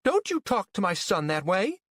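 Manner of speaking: high-pitched indignant scolding; irate. A narrator's reading. Mother defending her son from the rival, irate. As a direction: leap angrily to your son's defense. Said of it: "That finally spiked Mary's ire".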